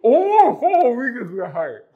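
muffled through a hand over the mouth. Acted. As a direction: speak with a hand in your mouth. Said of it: "こうして口に手を入れたら喋れなくなる".

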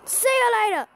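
high, sing-out send-off; taunting call. Real, not acted. Player dismissing the opponent's troop, taunting call.

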